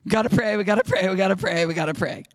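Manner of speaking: insistent chant, easing down in pitch; urgent looping refrain, loud at first; drumbeat repetition. Retold or imitated. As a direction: repeat it like beating a drum. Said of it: "Like beating the drum".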